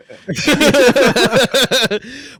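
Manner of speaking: high rolling laugh; long burst of laughter. Real, not acted. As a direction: crack up, pitched high. Laughing after teasing remark.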